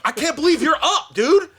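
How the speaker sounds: very high, loud mock shout; amazed hollering. Imitating someone walking past the hungover hero, amazed shouting. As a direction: holler in giddy disbelief. Passer-by amazed he is awake, retold.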